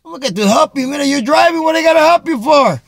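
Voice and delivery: loud, high, fast incredulous retort; mock indignant shout. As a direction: throw it back at her, outraged and loud. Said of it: "I'm like".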